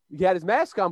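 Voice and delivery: ordinary talk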